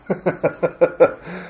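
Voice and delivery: soft contented chuckle; quiet laugh. Real, not acted. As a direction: laugh gently at the ending. Laughs at the happy ending.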